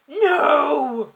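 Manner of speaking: long, high, very loud cry; horrified drawn-out wail; screaming. A narrator's reading. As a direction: scream it in shocked denial. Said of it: "she screamed".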